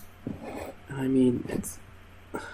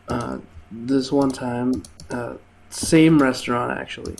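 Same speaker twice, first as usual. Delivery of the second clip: ordinary talk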